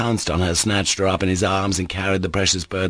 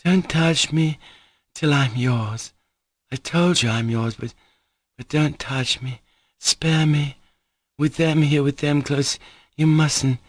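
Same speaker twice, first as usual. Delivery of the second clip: higher, urgent tearful pleading; pleading Grushenka; 7 semitones higher than usual. Acted. Grushenka pleading with Mitya while others are near. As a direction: beg him to wait, ashamed of the crowd.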